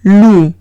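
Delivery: loup said the French way as /lu/, silent p; French word loup. Shown as a demonstration. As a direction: say loup like Spanish lu, no final p. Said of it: "La siguiente palabra es loup".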